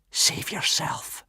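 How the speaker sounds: slow breathy whisper; mother whispering her last advice to her son. Acted. Mother's final words whispered.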